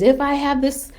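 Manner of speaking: high, loud and grandiose, swelling as she goes; crazed power-mad queen. Retold or imitated. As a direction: channel Galadriel tempted by the ring. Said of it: "And she says".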